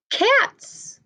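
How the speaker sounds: high, soft sung-out answer word; answer prompt. Shown as a demonstration. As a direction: model the answer for the kids, lilting up high. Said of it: "and they are both what?".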